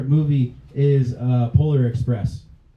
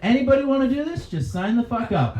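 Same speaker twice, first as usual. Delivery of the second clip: careless recruiter; 10 semitones higher than usual. Retold or imitated. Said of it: "they were just like".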